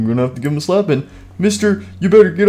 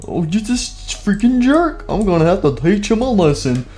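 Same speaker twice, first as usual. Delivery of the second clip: ordinary talk, nothing marked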